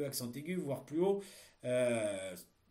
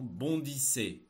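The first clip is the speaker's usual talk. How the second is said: dictating; 4 dB louder, much slower than usual. Shown as a demonstration. Teacher dictating a text.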